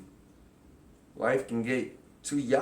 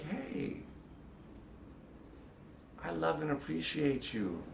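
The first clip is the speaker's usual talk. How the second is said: tender reassurance; 7 dB quieter than usual. Pretend. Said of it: "You'd be like".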